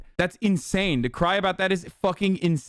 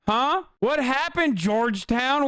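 higher mocking taunt; 7 semitones higher than usual. Real, not acted. Streamer taunting the Georgetown guest, mocking and higher. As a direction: needle him, mocking and higher.

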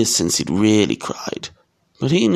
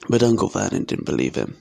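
usual speaking voice

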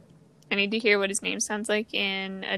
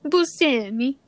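put-on Portuguese accent; 3 semitones higher than usual. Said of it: "Let's do some Portuguese".

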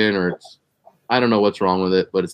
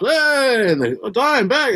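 excited fans; 12 semitones higher, slower than usual. Retold or imitated. Cops greeting star excitedly.

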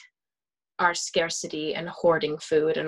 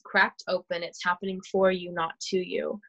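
ordinary talk

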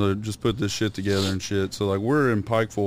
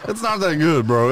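laughing; 7 semitones higher, 3 dB louder than usual. Real, not acted. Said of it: "my voice got deeper".

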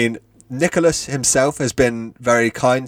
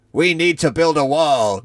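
a lightly voiced Trump, higher and far from his own voice; Donald Trump screech; 5 semitones higher than usual. Reading fan fiction aloud, mock Trump screeching higher. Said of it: "Donald screeched".

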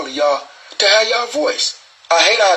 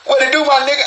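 excited greeting; 10 semitones higher, faster than usual. Real, not acted. Greeting a caller on stream.

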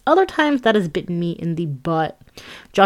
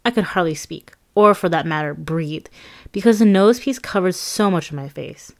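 ordinary delivery

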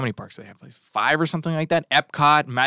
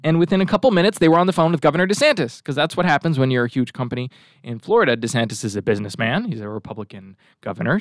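ordinary talk, nothing marked

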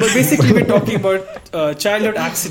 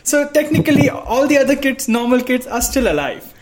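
high, loud excited rise; 8 semitones higher, 4 dB louder than usual. Real, not acted. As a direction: build up the joke excitedly. Joke punchline, excited.

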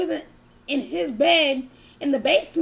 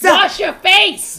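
louder, very slow order; loud slow command; 4 semitones higher, 9 dB louder, much slower than usual. Acted. Telling a crusty-eyed date to clean up, loud slow command. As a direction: bark it out slowly. Exaggerated advice.

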